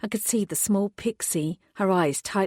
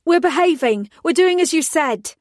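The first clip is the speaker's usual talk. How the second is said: much higher, loud shout to stop a gunman; yelled; 10 semitones higher, 7 dB louder than usual. Acted. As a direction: yell it out to save him. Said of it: "I yelled".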